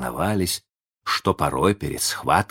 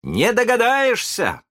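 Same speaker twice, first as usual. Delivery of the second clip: high, slow, triumphant cry; proud shout; 3 dB louder than usual. A narrator's reading. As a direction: crow it out proudly. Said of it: "кричал с гордостью Питер". (гордый высокий крик)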